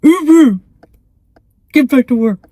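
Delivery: loud, barking Marine hoot and order; a drill-sergeant roar sending the office back to work; gruff Marine. Retold or imitated. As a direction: bark it like a tough Marine sergeant. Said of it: "really gruff Marine go".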